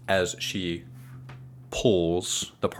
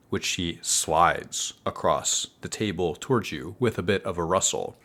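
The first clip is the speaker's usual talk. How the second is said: ordinary talk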